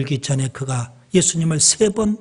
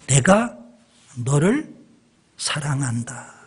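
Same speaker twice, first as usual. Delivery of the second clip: tender Jesus voice; 4 semitones lower, slower than usual. Acted. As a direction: say it gently as Jesus, trailing off. Jesus' voice, love declared.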